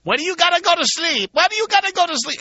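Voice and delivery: shrill high Eastern European shriek; Eastern European accent. Retold or imitated. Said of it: "with some Eastern European accent".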